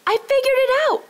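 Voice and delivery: high, loud cry of discovery; excited shout. A narrator's reading. Robbie jumps up shouting he's solved it. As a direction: burst out with the answer. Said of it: "shouted Robbie".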